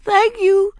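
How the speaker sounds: high, loud tearful thanks; sniffling thanks. A narrator's reading. Ramona remembering her manners, tearful sniffle. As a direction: sniffle it out through tears. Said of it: "sniffled Ramona".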